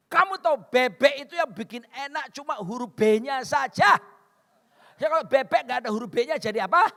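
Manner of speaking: high, loud mock protest; loud retort. Husband dodging wife's duck request, loud retort. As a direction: argue it punchily at full voice. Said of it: "Saya alasan berikutnya".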